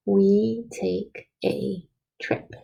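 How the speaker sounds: very slow, each word spaced out; slow dictation. Shown as a demonstration. Teacher dictating the sentence to children, very slowly. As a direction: dictate it slowly so kids can write along. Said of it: "see if you have it like I do".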